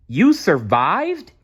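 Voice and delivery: high, slow, delighted question; amazed joy. Acted. Stella Luna to her mother, amazed high question. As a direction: marvel out loud at the news.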